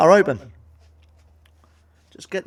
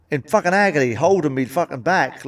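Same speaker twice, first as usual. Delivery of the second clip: ordinary talk, nothing marked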